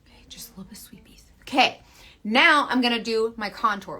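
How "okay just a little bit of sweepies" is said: very soft, quick whisper. Shown as a demonstration. Showing viewers a little light sweeping, whispering. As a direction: barely breathe it out, quickly.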